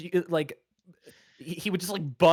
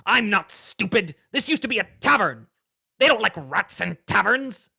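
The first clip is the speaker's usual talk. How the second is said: louder, indignant rat voice; 5 semitones higher, 7 dB louder than usual. Acted. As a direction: insist you're no fool. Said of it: "And he says".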